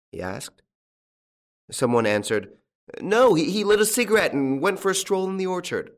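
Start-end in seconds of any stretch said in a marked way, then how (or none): 3.0-5.9 high-pitched villager voice; high, loud country onlooker; bystander's answer. A narrator's reading. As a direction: pipe up with a halting start.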